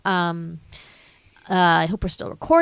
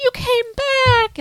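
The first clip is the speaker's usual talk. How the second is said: much higher, slow, gushing; Dorothy's delight; 4 dB louder, much slower than usual. Retold or imitated. Dorothy greeting Toto back home, high and slow. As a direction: coo it like an overjoyed girl. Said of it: "She's like".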